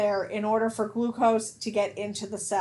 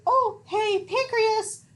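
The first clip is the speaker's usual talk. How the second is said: much higher, louder cartoonish call; body hailing pancreas; 11 semitones higher, 4 dB louder, slower than usual. Body alerting the pancreas at mealtime, high hailing voice.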